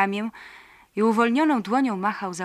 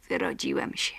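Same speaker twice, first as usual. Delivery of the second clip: lower, quiet, to himself; low, distant inner voice; absent-minded repeat; 7 semitones lower, 4 dB quieter than usual. Acted. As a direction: mumble it absently. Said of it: "zaczął bezmyślnie powtarzać Paweł".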